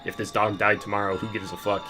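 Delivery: ordinary delivery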